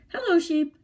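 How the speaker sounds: chirpy little duck saying hello; cheerful duck voice. Acted. Duck greets sheep.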